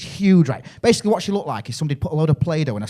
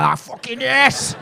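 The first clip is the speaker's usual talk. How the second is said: put-on drunken cheer; 10 semitones higher, much slower than usual. Drunk Brit cheering tequila.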